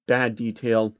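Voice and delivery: ordinary delivery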